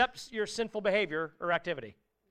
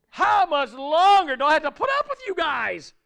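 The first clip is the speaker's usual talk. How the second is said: high, loud, fed-up rant; exasperated complaint; 7 semitones higher, 7 dB louder than usual. Retold or imitated. Jesus losing patience with his disciples, high, loud exasperation. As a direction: throw up your hands at the disciples. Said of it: "he's so perturbed with them".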